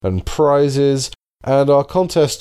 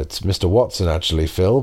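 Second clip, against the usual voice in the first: ordinary delivery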